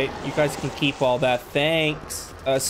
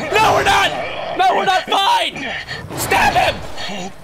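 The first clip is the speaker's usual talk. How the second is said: very high, loud yelling; panicked shout; 5 dB louder, slower than usual. Real, not acted. Player attacked just after saying all is fine, panicked shout. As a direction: scream it out in sudden alarm.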